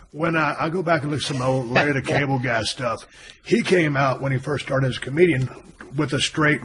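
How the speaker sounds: usual speaking voice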